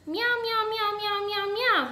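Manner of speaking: high-pitched cat voice; high singsong cat chatter; cat meowing. A narrator's reading. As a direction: meow the words in a row. Cat character speaks in meows.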